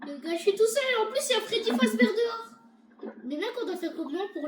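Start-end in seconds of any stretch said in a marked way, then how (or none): 0.1-2.5 raised, louder alarmed voice; panicked complaint. Pretend. Player alone with Freddy outside, high panicky complaint. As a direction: wail to the viewers in alarm.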